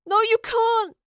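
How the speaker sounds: very high, desperate shout; yelled protest. A narrator's reading. Astrid protesting as the professor leaves, yelling. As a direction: shout to hold someone back. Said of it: "Astrid yelled".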